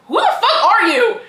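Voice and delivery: loud, high mock-outraged challenge; indignant shout. Host confronting the stranger in the lobby, indignantly loud. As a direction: fire it back, pitch jumping up.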